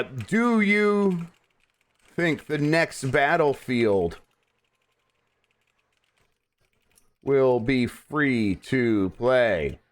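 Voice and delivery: very slow, stretched-out words; dictating while typing. Real, not acted. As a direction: say it piece by piece with long pauses, as you type. Typing a poll while reading aloud.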